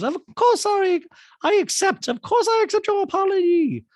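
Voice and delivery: very high, loud falsetto impression; hammed-up piercing high voice; Dorit. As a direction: shriek it out in mock graciousness. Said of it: "Dorit goes".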